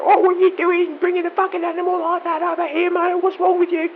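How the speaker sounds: high, loud outraged parent voice; angry parent. Retold or imitated. Parents scolding the narrator over the scratched kid, high shrill outrage. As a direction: tear into him like a furious mum. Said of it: "the parents going off at me like".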